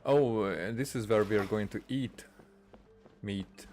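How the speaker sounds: normal talking voice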